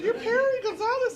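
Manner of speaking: laughing exclamation of recognition; excited realization. Retold or imitated. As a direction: blurt out the name in delighted disbelief. Realizing who the man is.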